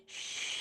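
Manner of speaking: soft hushing shh on the exhale; long quiet shh breath. Shown as a demonstration. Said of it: "Remember that sound".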